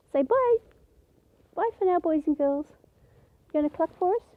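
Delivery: high, sing-song coaxing to a pet. Acted. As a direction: coax the hen to say bye.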